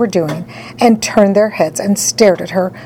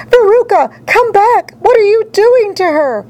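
very high, frantic mother's cry; scream; 12 semitones higher, 4 dB louder than usual. A narrator's reading. Mother calls back her daughter seized by squirrels, high screaming. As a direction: call out in a high, panicked screech. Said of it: "screamed Missus Salt".

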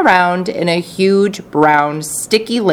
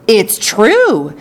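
bright, louder cry of agreement; a lively chorus exclaiming it; excited cry; 5 semitones higher, 4 dB louder than usual. Acted. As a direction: call it out together, eager. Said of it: "cried all four of the old people".

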